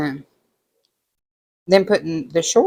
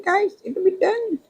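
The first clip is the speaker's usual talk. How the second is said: "It would be done" in a much higher, squeaky voice; squeaky high repeat. Real, not acted. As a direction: jump way up for the repeat. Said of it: "And it would be done".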